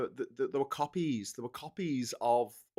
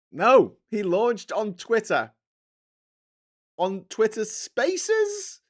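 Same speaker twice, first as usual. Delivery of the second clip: slow, high disbelieving delivery; incredulous; 10 semitones higher, 9 dB louder, much slower than usual. Real, not acted. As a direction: spell out the disbelief. Disbelief at launch venue.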